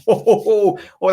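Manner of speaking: high, loud laughing exclamations; giddy laughing disbelief. Real, not acted. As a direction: burst out laughing in delight. Excited by Salah hat-trick prediction.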